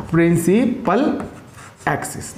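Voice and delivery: Principal drawn out slowly, then axis fades away; slow emphasis. Shown as a demonstration. Teacher stressing a new term for his class. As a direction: stretch principal, let axis trail off.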